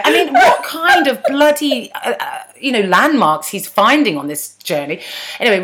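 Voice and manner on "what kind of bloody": ordinary talk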